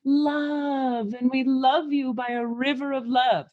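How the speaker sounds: hammed-up loving channelled voice; heart-centered gushing love. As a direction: pour it out in long connected tones. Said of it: "which is very heart-centered".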